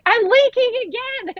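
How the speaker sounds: high squealed mock yell; retold scream. Telling her husband the waters went again, a high mock squeal. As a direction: squeal it up high, hamming up the panic. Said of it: "I've screamed at my husband again".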